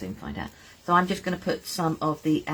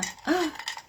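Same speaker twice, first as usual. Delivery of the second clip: delighted gasp; 13 semitones higher, 4 dB quieter than usual. Real, not acted. Delighted at upcycled mug.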